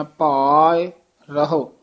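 long ਭਾਇ, clear pause, then ਰਹੋ; dash between words. Shown as a demonstration. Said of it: "dash ਜੀ ਵਿੱਚ ਦੇ ਕੇ ਉਚਾਰਨ ਕਰਨਾ". (ਭਾਇ ਤੋਂ ਬਾਅਦ ਵਿਰਾਮ, ਫਿਰ ਰਹੋ)

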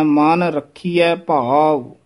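ਰੱਖੀਐ said slowly with a tight kh; tight pronunciation. Shown as a demonstration. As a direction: press ਰੱਖੀਐ firmly. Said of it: "ਥੋੜ੍ਹਾ ਜਿਹਾ ਕੱਸ ਕੇ ਬੋਲਣਾ ਹੈ".

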